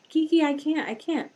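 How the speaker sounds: put-on lightly high whiny child; a hint of a pouting toddler. Nephew insisting to Kiki that he can't, light whine. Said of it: "did you say I can't".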